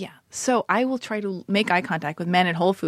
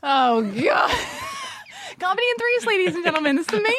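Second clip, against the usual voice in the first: laughing hard; 11 semitones higher than usual. Real, not acted. Cracking up at joke.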